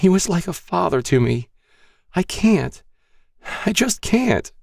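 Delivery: high, breaking with grief; shaky voice. A narrator's reading. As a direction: voice trembling, close to tears. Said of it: "her voice grew shaky".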